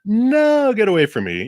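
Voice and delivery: sudden high mock yell; hammed-up shriek; pretend scream. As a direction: snap it out like shooing someone off. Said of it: "I'm not gonna scream at 'em".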